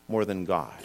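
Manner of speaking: usual speaking voice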